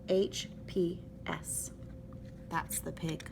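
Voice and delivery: slow whispered initials. Acted. Hector signing his card to Adeline, whispered. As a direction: breathe the initials out slowly, no voice.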